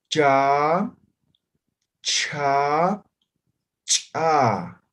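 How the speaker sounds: cha plain, then cha with a burst; Aymara three-level demo. Shown as a demonstration. Teacher modelling Aymara consonant series, loud and deliberate. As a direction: model cha, cha crisply, one at a time. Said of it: "Los tres niveles de sonido".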